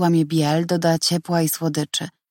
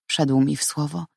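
ordinary delivery

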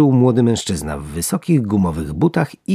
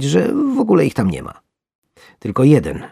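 plain reading voice

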